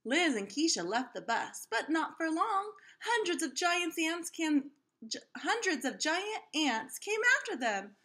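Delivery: lively storybook read-aloud voice; reading to children. A narrator's reading. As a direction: read it like story time for a class. Reads picture book aloud to kids.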